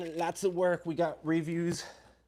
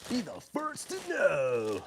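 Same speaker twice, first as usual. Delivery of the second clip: slow echo; 3 semitones higher, much slower than usual. Pretend. Echoes the ad line slowly.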